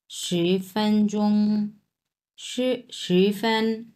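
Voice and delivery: শি ফ্যানচোং said slowly with level tones; slow Chinese model. Shown as a demonstration. Teacher drilling students in slow Chinese. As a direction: spell out শি ফ্যান syllable by syllable. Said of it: "তাহলে কি বলবো আমরা".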